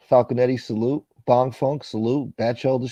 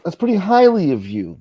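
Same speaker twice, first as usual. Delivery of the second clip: higher-pitched English impression; mock English accent; 7 semitones higher, 3 dB louder than usual. Co-host echoes the acquaintance's odd phrase, mock English accent. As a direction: echo the phrase in imitation English. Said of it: "he has an England accent".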